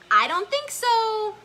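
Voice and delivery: high, loud, slow character voice; Albie's voice. Acted. Albie answering Elmo's question, high drawn-out character voice. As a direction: draw it out high like a little character refusing. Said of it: "Albie shakes his head".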